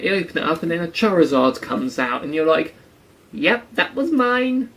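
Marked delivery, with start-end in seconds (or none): 3.3-4.7 much higher, slow, sheepish admission; mock sheepish. Pretend trainer finding a Charizard, sheepish. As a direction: own up awkwardly, dragging it out. Owner sees Charizard come out.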